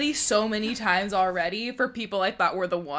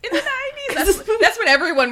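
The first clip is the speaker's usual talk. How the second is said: laughing; 11 semitones higher, 5 dB louder, faster than usual. Real, not acted. Laughing at the nineties remark.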